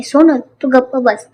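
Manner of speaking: ordinary delivery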